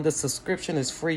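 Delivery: ordinary talk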